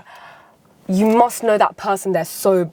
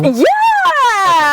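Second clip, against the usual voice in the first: excited; 12 semitones higher, 5 dB louder than usual. Real, not acted. Cheering correct answer.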